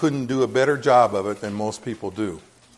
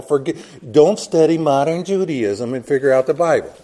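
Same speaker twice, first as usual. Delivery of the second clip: louder, insistent pulpit preaching; 3 semitones higher, 6 dB louder than usual. Acted. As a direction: drive the point home from the pulpit. Preaching at full force.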